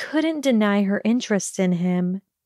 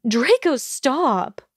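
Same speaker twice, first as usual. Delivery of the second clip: higher, drawn-out protest, acted; offended bark; 6 semitones higher, slower than usual. A narrator's reading. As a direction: cut him off in a high, offended voice. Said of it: "she barked with offense".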